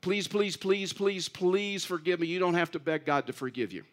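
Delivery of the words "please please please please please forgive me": rapid-fire run of pleading pleases, pitched up; begging. Acted. Preacher mimics believers begging God for forgiveness, begging. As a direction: plead over and over, voice raised. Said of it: "we just start begging".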